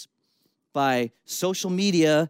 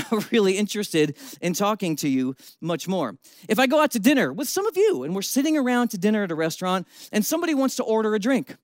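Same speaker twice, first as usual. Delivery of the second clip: ordinary talk, nothing marked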